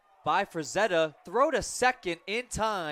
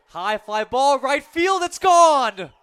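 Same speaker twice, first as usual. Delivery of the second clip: rising, roaring high home run call; 9 semitones higher, 13 dB louder, slower than usual. Real, not acted. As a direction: build to a huge shout on gone. Announcer calls a home run.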